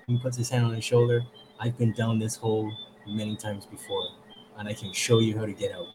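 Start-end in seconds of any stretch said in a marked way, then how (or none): 1.6-5.9 softer, quiet voice of reassurance; low-key, soft comfort in a retold story; reassuring friend. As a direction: play a buddy who calmly offers a way out. Friend reassures man, hand on shoulder.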